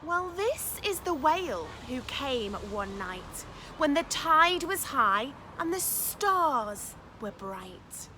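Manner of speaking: put-on picture-book reading for children; lively storytime narration; story narration. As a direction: read it aloud with wonder for little ones. Reads picture book aloud.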